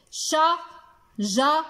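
شجرة very slowly as sha, ja, ra, tun; split into syllables. Shown as a demonstration. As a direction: leave a gap after each syllable of شجرة. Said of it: "أين سمعنا صوت الجيم".

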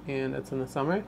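ordinary talk

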